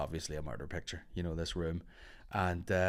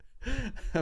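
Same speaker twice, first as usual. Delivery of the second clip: high laugh. Real, not acted. Laughing at the memory.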